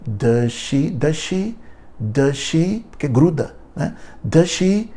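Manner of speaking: "Does she" run together, the z melting into "she"; blended does she. Shown as a demonstration. Teacher demonstrating the blending in "Does she". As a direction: glue "Does" straight onto "she", no z. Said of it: "Isso se chama blending".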